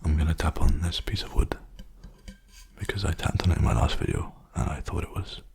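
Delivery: unvoiced ASMR whisper. Real, not acted. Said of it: "I keep whispering, sorry, force of habit".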